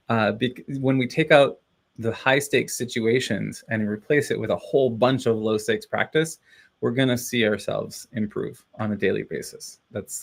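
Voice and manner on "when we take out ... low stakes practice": ordinary talk, nothing marked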